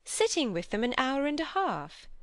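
high, incredulous exclamation; exclaiming. A narrator's reading. Mary hearing how long her brother stayed, exclaiming. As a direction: echo it back in lifted disbelief. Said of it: "exclaimed Mary".

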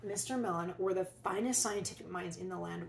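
ordinary delivery

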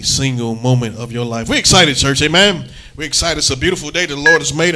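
loud rousing pulpit call; preaching. Acted. Pastor firing up the congregation on Sunday morning. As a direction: stir up the church, excited.

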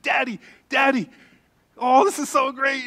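put-on high, loud giddy child; excited little girl. Preacher imitating his daughter calling Daddy, excited. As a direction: imitate a daughter bursting with news. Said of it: "she was so excited".

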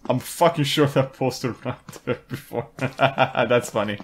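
breaking into chuckles; amused. Real, not acted. Player amused by a poster in the game, laughing. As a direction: let laughter spill out mid-remark.

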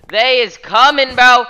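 They is coming, high and loud; high shouted. Shown as a demonstration. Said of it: "They are coming".

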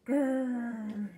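loud growled grrr; tiger growl. Acted. Teacher growling as a tiger for children. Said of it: "here's a tiger".

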